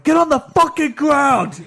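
screamed high border cop order; shouting. Pretend. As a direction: bellow it, laughing.

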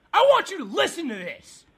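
loud, high, insistent order; angry shouted demand. Real, not acted. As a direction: shout to make them listen. Demanding agent hear hang-up message.

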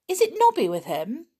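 eager high question; high eager child. Acted. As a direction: ask with rising excitement.

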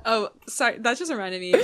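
normal talking voice

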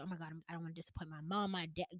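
fast, soft, panicked rush of worry. Retold or imitated. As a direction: rattle it off under your breath in a panic. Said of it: "I was just".

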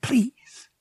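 put-on high, small girl's plea; young woman pleading. Her plea after whisper.

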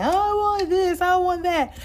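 mock whining child.

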